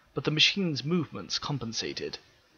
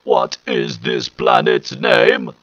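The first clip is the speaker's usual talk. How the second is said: higher, louder, slow harsh robotic Dalek voice; 8 semitones higher, 12 dB louder, much slower than usual. Acted. Alien machine questioning the planet's people, slow harsh robot voice. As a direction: grind out each word like a machine. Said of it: "The voice was deep and almost hysterical sounding".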